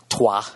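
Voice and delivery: "Trois" said the French way, the r at the back of the throat; tossing in "Trois" as a French speaker would; French number. Shown as a demonstration. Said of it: "however the fuck you say zero".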